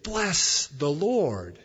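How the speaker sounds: slow, drawn-out call to bless; David blessing. Retold or imitated. David calls his soul to bless, slowly. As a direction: intone it like a psalm. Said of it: "That's why David said".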